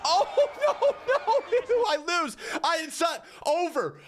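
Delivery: very high, laughing no's; laughing disbelief. Real, not acted. Streamer realizing he lost the challenge, high laughing disbelief. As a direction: crack up as you realize you've lost.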